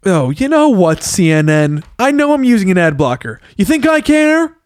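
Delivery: lightly voiced stand-up indignation; Seinfeld-ish voice. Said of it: "you kinda sound like Jerry Seinfeld".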